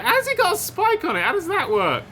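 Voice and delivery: high, baffled squeak; surprised. Retold or imitated. Retold confused thought.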